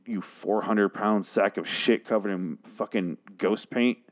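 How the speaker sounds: ordinary talk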